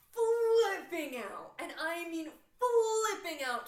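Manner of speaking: "flipping" stretched out, high and very loud; stress on FLIPPING. Real, not acted. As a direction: blast out a long, drawn "flipping". Emphatic retelling of mom's panic.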